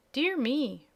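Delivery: ordinary delivery, nothing marked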